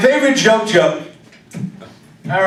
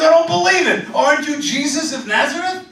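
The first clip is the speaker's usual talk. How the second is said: higher, astonished tailor's voice; amazed tailor; 7 semitones higher than usual. Retold or imitated. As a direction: gasp it out in wonder. Tailor recognises Jesus.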